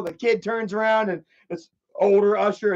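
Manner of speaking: normal talking voice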